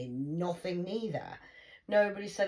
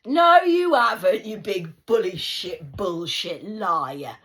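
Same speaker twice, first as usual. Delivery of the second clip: angry shout; 11 dB louder, slower than usual. Real, not acted. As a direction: tear into the liar word by word. Shouting at Trump.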